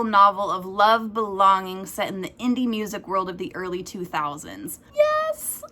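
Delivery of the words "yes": sudden high squeal of joy; excited squeal. Real, not acted. Booktuber squealing over her next arc. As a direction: squeal yes like a fan.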